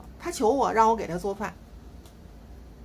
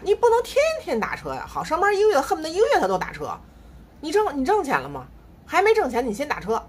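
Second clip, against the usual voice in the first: exasperated scolding; 7 semitones higher than usual. Real, not acted. Mother scolding her son over taxis, exasperated and high. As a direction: scold the spendthrift son, pitch up.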